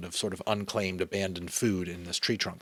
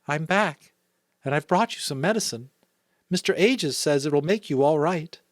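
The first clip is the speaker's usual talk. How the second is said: higher-pitched mother's voice; mother to sick child; 8 semitones higher, 6 dB louder than usual. A narrator's reading. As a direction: comfort a sick child, voice lifted. Mother comforts sick son in story.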